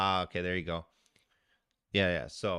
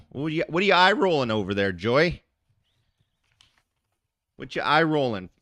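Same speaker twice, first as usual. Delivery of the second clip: playful call-out; 6 semitones higher, 9 dB louder than usual. Real, not acted. Calling out a viewer in chat.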